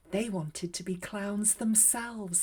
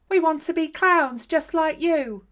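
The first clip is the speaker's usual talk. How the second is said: higher and louder, eager little mice; mouse voices; 9 semitones higher, 12 dB louder than usual. A narrator's reading. Mice introducing themselves to the clown, high, loud and eager. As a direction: squeak it out like two tiny eager mice. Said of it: "said the mice".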